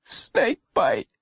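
put-on high, thin child voice; high-pitched and pained; weak hurt boy. A narrator's reading. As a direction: answer feebly as an injured boy. Injured boy answers policeman.

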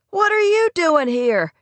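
excited. A narrator's reading. Teenager thrilled to see Rory back, excited. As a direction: burst out with delighted surprise. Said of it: "his voice high and excited".

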